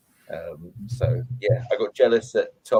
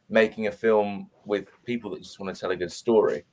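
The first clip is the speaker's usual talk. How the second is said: usual speaking voice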